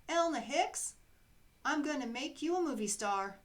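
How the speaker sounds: high, loud showbiz hype; Hollywood producer. Acted. Producer promising Elna movie fame, hyped. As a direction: sell stardom with big flair.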